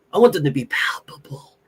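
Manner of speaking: breathy intense whisper. Real, not acted. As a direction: hush it like a secret craving. Hushed hype to audience.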